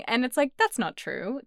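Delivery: ordinary talk, nothing marked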